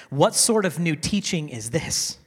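normal talking voice

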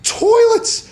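squeaky high sign reading; high announcing voice. Retold or imitated. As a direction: say it high and drawn out. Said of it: "And the sign says".

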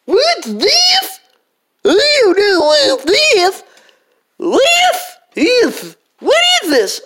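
put-on squeaky high puzzled kid; high and baffled; high character voice.

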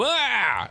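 high startled "Ah"; startled yell. Retold or imitated. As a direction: yelp at a scare. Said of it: "that will make you go".

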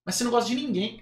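normal talking voice

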